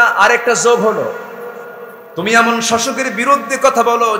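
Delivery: loud, high furious scolding; woman furious at the stranger's insult, loud and high; angry woman. Acted. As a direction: rage at him for insulting the ruler. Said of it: "রেগে গিয়ে বলতেছে".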